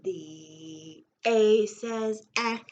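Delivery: A says ah, drawn out slowly and loud; slow letter sound. Shown as a demonstration. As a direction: stretch out "ah" loud and slow like a teacher. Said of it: "Every letter makes a sound".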